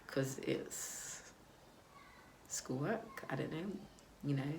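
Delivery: almost whispered, very soft, unsure; timid murmur. Retold or imitated. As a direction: mumble the excuse under your breath, shrinking away. Her timid answer to boyfriend.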